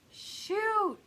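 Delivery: sudden high, loud yelp; startled high cry; startled exclamation. Real, not acted. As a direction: blurt it out in surprise. Reacting to cards.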